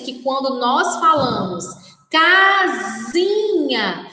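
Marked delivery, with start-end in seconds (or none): 2.1-4.1 "casinha" slowly, split into ca and zinha; "casinha" drawn out, CA standing out; stress on "ca". Shown as a demonstration. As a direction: stretch the first syllable of "casinha". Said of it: "a sílaba K está destacada".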